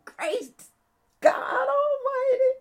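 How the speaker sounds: high, drawn-out exultant cry; soaring church holler; preacher's yell. Acted. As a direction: cry it out like praise bursting from the pews. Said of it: "you might yell".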